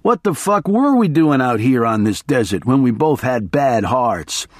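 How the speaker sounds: plain reading voice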